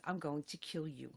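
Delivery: quiet, low deadly warning; mom's hushed menace, retold; low threat. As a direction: say it under your breath as a threat. Mother's threat from front seat retold.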